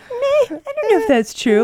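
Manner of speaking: high-pitched, lightly dismissive imitation; playful imitation of nonchalance; mock nonchalant. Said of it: "be nonchalant about it".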